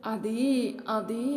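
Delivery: drawn-out, hesitant stalling; bashful wife avoiding the answer; coy hesitation. A narrator's reading. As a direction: stall shyly with pauses between words. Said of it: "అంటూ మెలికలు తిరుగుతుంది భూమి".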